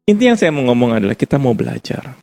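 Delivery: ordinary delivery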